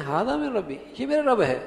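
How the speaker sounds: high-pitched disbelief; astonished, rejecting query; incredulous question. Shown as a demonstration. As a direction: ask it as a denial, voice leaping up. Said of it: "لہجے کے لحاظ سے انکار".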